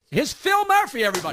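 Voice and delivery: high, loud stage intro; MC introduction. Real, not acted. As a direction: announce the comic to a crowd. Host announces comic to the crowd.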